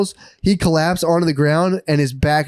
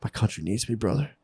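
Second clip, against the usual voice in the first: a hint of noble soldier, much softer; lightly voiced self-important patriot; mock-solemn reply; 3 semitones lower, 10 dB quieter than usual. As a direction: psychic answering officers, softly and mock-grave. Psychic's mock-noble reply to officers.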